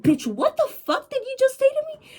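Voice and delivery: high-pitched, hammed-up angry comeback; imagine snapping back in a shrill squeal of indignation; mock outrage. Girl imagining her comeback to the receptionist, mock outrage. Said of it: "I would get mad as fuck".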